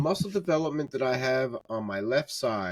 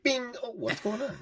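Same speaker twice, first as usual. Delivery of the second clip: very high, softer mock confusion; mock bewildered archer; 13 semitones higher, 4 dB quieter than usual. Teasing friends pulling his bow, high mock bewilderment. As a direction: tease them with a squeaky-high imitation. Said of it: "You guys would pull 'em on, you'd be like".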